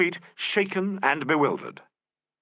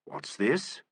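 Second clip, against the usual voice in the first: suspicious; 5 semitones lower, 6 dB quieter than usual. A narrator's reading. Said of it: "demanded Nigel suspiciously".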